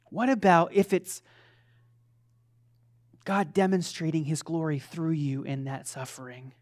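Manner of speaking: normal talking voice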